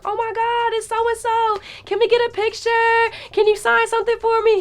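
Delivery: excited fans. Retold or imitated. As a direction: gush like starstruck fans. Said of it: "these two girls are like".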